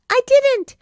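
high, loud protesting yelp; cried out. A narrator's reading. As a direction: shout the denial like a guilty little boy. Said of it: "he cried".

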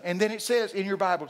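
ordinary talk

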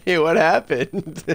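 high squealing laugh with a slow amazed question; giggling fit. Real, not acted. Gamer cracking up at the chaos in the garden, laughing. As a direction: break up laughing, can't believe it.

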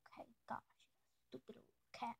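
hushed, teasing grumble at the cat; affectionate whispered insult; whispered mock scold. Real, not acted.

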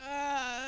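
long drawn-out "uhhh"; flustered stalling noise. Acted. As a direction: stall on one sustained dithering groan. Acting out her flustered navigating.

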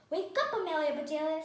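calling to wake. A narrator's reading. Missus Ruggers waking Amelia Bedelia in the car, calling out. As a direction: rouse a sleeper with a bright call.

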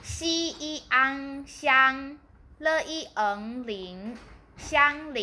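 teacher's careful spell-out ending on the whole name 香菱; syllable spelling. Shown as a demonstration. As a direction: spell out 西一安 and blend it into 香. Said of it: "来拼一下".

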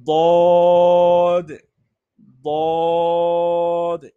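দদ thick and heavy from the tongue's side; Arabic letter daad. Shown as a demonstration. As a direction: say দদ emphatic, full-mouthed. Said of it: "এই হরফটাও একটু মোটা হবে".

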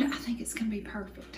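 hushed whisper, as if sharing a secret. Real, not acted. Telling viewers a cooking secret, in a whisper. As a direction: lean in and whisper it.

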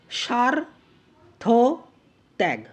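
সার, থ, ত্যাগ each said apart with long pauses; syllable by syllable. Shown as a demonstration. Teacher splitting a word to count syllables, syllable by syllable. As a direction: break the word into slow, separate syllables. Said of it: "কেন বলা হচ্ছে দেখি".

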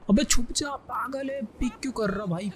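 snapping at a teammate to hide; alarmed shout. Real, not acted. Gamer yelling at teammate to take cover, high alarmed shout.

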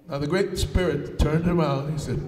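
usual speaking voice

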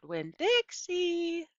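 high, drawn-out call out the door; slow high holler across the yard. A narrator's reading. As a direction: call the dog's name, stretching it long. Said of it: "I hollered".

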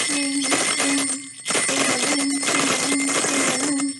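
put-on singsong ding-a-ling for the kids; bell imitation. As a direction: ring it out like little bells. Imitating Yellow Bear's bells.

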